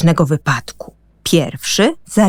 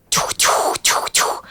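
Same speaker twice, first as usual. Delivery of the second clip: whispered train chuff; slower than usual. Acted. Toy train chugs along while talking to friends. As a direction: puff it out like a little steam train.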